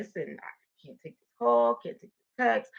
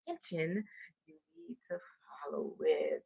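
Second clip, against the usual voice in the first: whisper; 4 semitones lower, 13 dB quieter than usual. Real, not acted. Coach drops to a whisper to the viewers. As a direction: drop to a hushed whisper.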